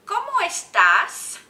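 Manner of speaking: loud, high, slow question; big, stretched-out question for the class; teacher modelling a question. Shown as a demonstration. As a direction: project it brightly to little learners. Models new question to children.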